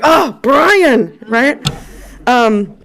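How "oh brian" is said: knowing exasperation. Retold or imitated. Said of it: "She's like".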